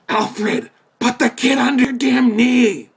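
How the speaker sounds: mock-furious shout; exasperated yell at Alfred. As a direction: bark it at the screen like a frustrated fan. Yelling at Alfred on screen.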